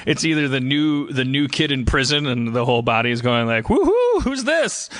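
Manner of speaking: normal talking voice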